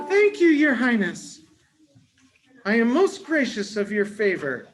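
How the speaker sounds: high, loud grateful squeak; squeaky mouse voice. Acted. Mouse thanking the lion king, grateful squeak. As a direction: gush thanks in a tiny voice.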